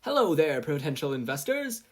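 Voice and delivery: high, loud pitchwoman voice over a speaker; blaring sales pitch. Acted. Mei greeting investors over the speakers, blaring. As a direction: sell it to the crowd like an ad. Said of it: "Mei's voice blared from the speaker system".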